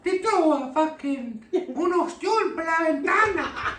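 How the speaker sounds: high-pitched, hammed-up Spanish-speaking housekeeper. Housekeeper complaining about oysters through the window, high and aghast. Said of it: "the housekeeper like".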